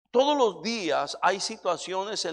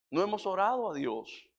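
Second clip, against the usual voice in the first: usual speaking voice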